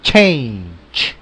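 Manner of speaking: change said the English way; change, the ch as in church. Shown as a demonstration. Teacher modelling the word for students. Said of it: "alguien que me la diga por ahí".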